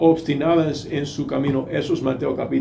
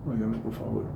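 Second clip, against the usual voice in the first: much softer, gentle begging call; soft pleading; 5 semitones lower, 14 dB quieter, faster than usual. Shown as a demonstration. God pleading softly with his son. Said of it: "Dios te puede hablar diciendo".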